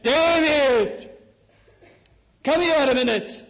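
high, loud summons with a drawn-out name; calling out. Acted. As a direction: call out as a preacher voicing God.

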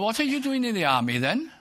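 mock astonished officer; incredulous. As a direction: ask it as if you can hardly believe it. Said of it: "You would say".

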